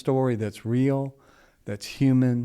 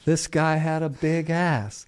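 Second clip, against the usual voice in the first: chuckling, slowed down and a little higher; laughing through it; 6 semitones higher, slower than usual. Real, not acted. Teller laughing as he tells listeners about the husband. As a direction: let the laugh break into the words. Laughing while retelling punchline.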